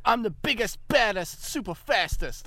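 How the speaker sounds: imitating a small boy's American boast, pitched high; high, punchy mock-American kid voice; son's American accent. Dad imitates his three-year-old, boastful American accent. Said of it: "He's three and a half".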